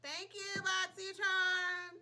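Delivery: sing-song thanks. Real, not acted. Sings out thanks to sponsor.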